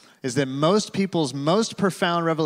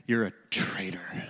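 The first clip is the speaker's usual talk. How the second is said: put-on child's silent accusation; 5 semitones lower, 5 dB quieter than usual. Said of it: "with his eyes, he's communicating".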